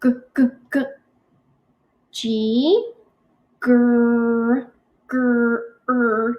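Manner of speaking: G, g, gr, r said as single sounds; isolated sounds. Shown as a demonstration. Teacher sounding out ground for class, sound by sound. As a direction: sound out each letter alone. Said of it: "now we have to sound out ground".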